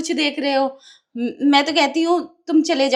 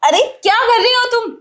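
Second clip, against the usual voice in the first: high, louder outraged question; 7 semitones higher, 4 dB louder than usual. Acted. Wife appalled at the man's demand, outraged. Shocked at advances.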